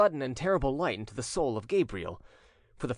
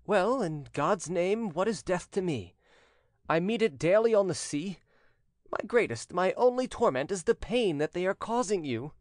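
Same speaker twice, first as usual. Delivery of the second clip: put-on higher-pitched, impassioned young fisherman; earnest condemned son; 7 semitones higher than usual. A narrator's reading. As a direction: reassure your father with feeling. Condemned son answers his father.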